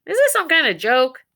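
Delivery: loud, irritated snap; snapping vet. Acted. Vet snapping at the caller on the phone, loud and irritated. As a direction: bite back like you're being pranked. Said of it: "snapped the vet".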